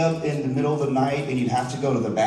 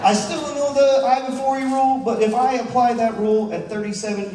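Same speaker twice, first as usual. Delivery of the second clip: exasperated; 9 semitones higher than usual. Real, not acted. Exasperated repeat of rule complaint.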